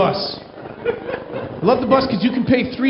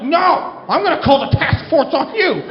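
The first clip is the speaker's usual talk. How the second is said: higher-pitched crazy lady shouting back; mock angry screaming; 5 semitones higher than usual. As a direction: fire it back as an irate woman. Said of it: "they just started screaming at each other".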